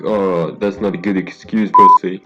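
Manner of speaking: loud jeer, last word shouted; sneer, then yell the insult; mocking bully voice. Bully mocking the narrator's excuse, sneering then shouted.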